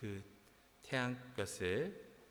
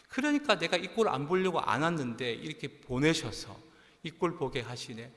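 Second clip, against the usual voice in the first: louder, higher outburst of complaint; angry grumbling; 5 semitones higher, 7 dB louder than usual. Acted. Preacher voicing Jonah complaining to God, angry grumbling. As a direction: protest indignantly as a mock-angry Jonah. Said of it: "요나가 너무너무 화가 났어요".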